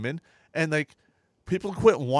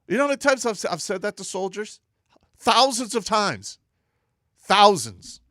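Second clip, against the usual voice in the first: emphatic; 7 semitones higher, 7 dB louder than usual. Real, not acted. Emphatic boast, rising loud.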